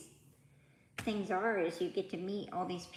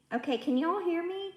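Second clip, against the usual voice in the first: raised, higher voice, a bit fast; calling out; 10 semitones higher, 7 dB louder, faster than usual. Real, not acted. Host checking her live viewers can hear her, calling out loudly. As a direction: call out to make sure the stream hears you.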